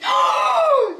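high sharp gasp of alarm; sudden startled gasp; loud shocked gasp. Real, not acted. Finds camera left running.

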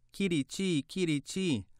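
high, chirpy hen voice; a small bird clucking its words out; little hen voice. Acted.

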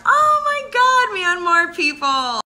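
very high, loud, slow cry of joy; delighted squeal. Real, not acted. Greeting fans from Myanmar, squealing with joy.

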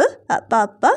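put-on baby Celine voice. Baby Celine says she is unhurt, low babyish voice. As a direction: answer as the little captive, voice dropped.

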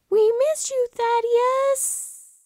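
very high, far-off squeaky goat voice; a tiny high voice calling from a fall; falling goat's cry. Acted. The uncle tumbling into a canyon, calling up in a high squeaky voice. Family calls from the cliffs.

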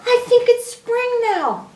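put-on high, loud, childlike announcement; excited shout. A narrator's reading. Young rabbit tells his mother spring has come, excited shout. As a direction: shout the big discovery in a high voice. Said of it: "he shouted".

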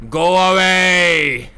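long, slow shout of go away at the ghost; drawn-out yell. Acted. Voice-command player yelling at the ghost, drawn-out loud command. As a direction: stretch the word away into a loud call. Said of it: "Go awayだけでいいんじゃね".

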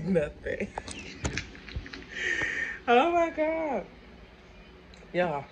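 smiling as she speaks; speaking through a grin; laughing while talking. Real, not acted. Seen 1: No ice cream visible; mouth empty, broad laughing smile at end.

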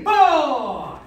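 loud, drawn-out number; drill count. Real, not acted. Coach calls count for the strike, loud shout. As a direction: project the count across the class.

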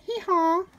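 high braying hee-haw; donkey bray. Acted. Reader brays for the donkey child.

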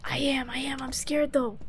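scared whisper, barely voiced; hiding, whispering to teammate. Real, not acted. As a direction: hush it so the ghost won't hear. Hiding from hunting ghost.

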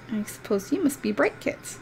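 ordinary talk

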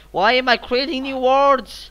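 high, loud, drawn-out self-reproach; exasperated outburst. Real, not acted. Gamer asking why they create new words, loudly exasperated. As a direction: shout at yourself slowly, in high-pitched disbelief.

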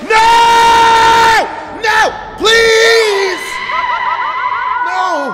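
long high screamed pleas; hammed-up terror, shrieking; panicked screaming. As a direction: scream for mercy at full volume. Caught by Peach in game.

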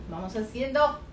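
normal talking voice

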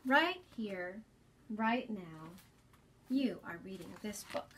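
put-on gentle storybook reading to young listeners; reading to children. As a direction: read it like story time for kids. Reads picture book aloud to children.

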